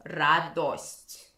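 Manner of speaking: радость with a clear full O after the Д; Church Slavonic O. Shown as a demonstration. Teacher contrasting Church Slavonic with Moscow Russian. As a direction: say радость with the round O. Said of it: "You have to say that O".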